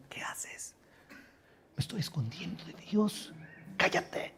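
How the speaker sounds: diálogo susurrado entre escondidos; susurro escondido. Acted. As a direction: susurra como quien se oculta de Dios. Diálogo escondido de Adán y Eva. (cuchicheo escondido)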